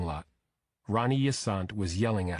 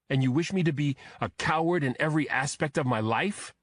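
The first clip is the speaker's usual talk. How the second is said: shouting; 6 semitones higher than usual. A narrator's reading. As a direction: throw the last line at her. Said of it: "He shouted this last bit".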